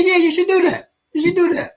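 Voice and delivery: high, sly fake encouragement; mock egging on. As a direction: egg him on while knowing better.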